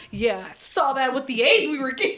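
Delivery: ordinary talk, nothing marked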